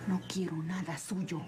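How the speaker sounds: slow, hushed refusal; bitter whisper. Acted. María refusing the man's gifts in a slow, bitter whisper. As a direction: breathe the words slowly with contempt. Refusing him again, hissed.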